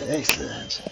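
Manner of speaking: whispered gleeful villain; spy whisper. Acted. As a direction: breathe it out, scheming. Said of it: "That's my spy voice".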